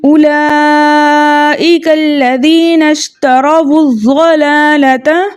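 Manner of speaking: slow chanted "उलाइका लज़ीना इश्तरवुज़ ज़लालता" with long held vowels; recitation demo. Shown as a demonstration. Teacher recites the full phrase for students, slow chant. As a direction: recite the phrase slowly and melodically as a model. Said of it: "हम पढ़ रहे हैं".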